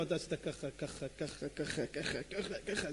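low murmur, nearly whispered; very soft, low repeated accusations; hushed listing. Acted. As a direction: reel off secrets under the breath. Rabbi privately listing someone's sins.

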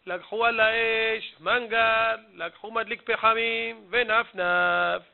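slow singsong lament; mock-tearful drawn-out complaint; weeping prayer shawl. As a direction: chant a list of grievances, stretching each ending. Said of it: "מקופלת בתוך הארון ובוכה".

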